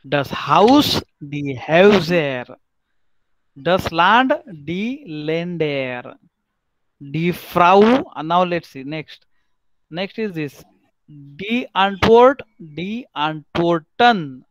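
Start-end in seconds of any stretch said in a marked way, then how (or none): 0.0-2.5 slow: Häuser as hoyzer; teacher modelling the umlaut plural; model pronunciation. Shown as a demonstration. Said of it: "teacher models plural". 4.7-6.2 very slow, soft: Länder with open e; teacher modelling the plural; model pronunciation. Shown as a demonstration. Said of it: "teacher models plural". 11.1-14.4 very slow: Antwort, then Antworten with -en; teacher modelling the plural; model pronunciation. Shown as a demonstration. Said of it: "teacher models plural".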